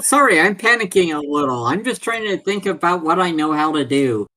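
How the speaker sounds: ordinary talk, nothing marked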